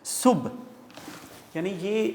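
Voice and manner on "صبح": صبح as a single syllable, "subh", set apart; correct pronunciation. Shown as a demonstration. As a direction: model صبح with no "a" after the b. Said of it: "بے اور ہے دونوں silent ہیں".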